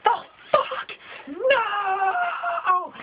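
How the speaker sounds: high, shocked "No!" cried out; shocked cry. Retold or imitated. Everybody and their mom at the TV, shocked cry. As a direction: wail in disbelief. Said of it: "everybody and their fucking mom just goes".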